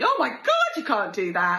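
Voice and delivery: high, loud gasp of disbelief; shocked friends. Retold or imitated. Friends hearing her plan, shocked high squeal.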